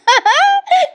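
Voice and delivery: very high squeal of laughter. Real, not acted. Vlogger cracking up at the scene, squealing laugh. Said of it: "sorry हो प्रेक्षकांनो".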